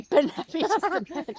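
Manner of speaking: high, loud, slow proclamation with laughter; mock boastful shout. Co-host playfully echoing the other's claim to importance, mock boastful shout. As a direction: crow it loudly, stretched out and laughing.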